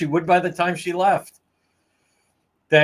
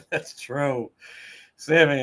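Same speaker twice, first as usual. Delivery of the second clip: ordinary delivery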